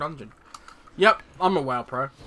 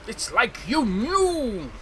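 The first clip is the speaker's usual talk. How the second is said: slow, high, drawn-out complaint; exasperated accusation; 7 semitones higher, 4 dB louder, much slower than usual. Real, not acted. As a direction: whine it at the screen. Accusing the game.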